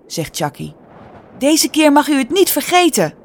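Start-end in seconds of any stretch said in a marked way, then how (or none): 1.4-3.1 high, raised outcry; urgent cry in a high voice. A narrator's reading. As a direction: cry it out, pitched well up. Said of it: "Ze schreeuwt het uit".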